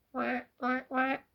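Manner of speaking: lightly voiced duck quacks. A narrator's reading. Duck answers.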